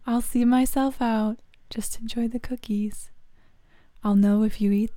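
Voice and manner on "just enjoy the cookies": hushed sulky whisper. Acted. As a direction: whisper it as you leave. Leaving after rejection.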